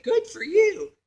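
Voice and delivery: high-pitched sneering mimicry; mock her with put-on sweet sarcasm; imitating a sarcastic coworker. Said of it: "like, real sarcastic".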